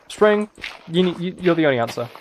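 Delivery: usual speaking voice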